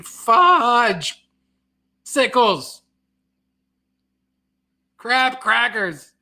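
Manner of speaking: high-pitched substitute swear words; hammed-up mock frustration; mock cursing. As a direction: exclaim silly curses, each drawn out. Mock swearing after fearing to offend.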